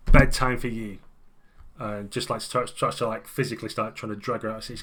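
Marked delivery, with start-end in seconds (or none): 0.1-1.0 much louder curt command; police chief ordering the woman home; stern order. Acted. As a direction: bark it like a cop ending the night.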